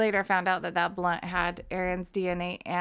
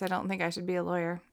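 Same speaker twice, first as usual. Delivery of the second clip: ordinary talk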